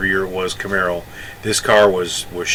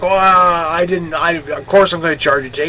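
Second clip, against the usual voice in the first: backpedaling clerk; 8 semitones higher, 4 dB louder than usual. Retold or imitated. Clerk backpedals to Walter on the tax, high and flustered. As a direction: scramble to take it back.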